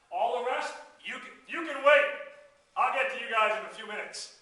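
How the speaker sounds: loud, emphatic call to a crowd. Acted. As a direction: project it, putting the rest on hold. Acts out putting other clients off.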